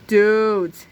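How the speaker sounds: drawn-out loud call, pitch kept down; mild yell. A narrator's reading. Skateboarder calls to his friends, mild yell. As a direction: call out to the gang. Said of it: "yelled one of the skateboarders".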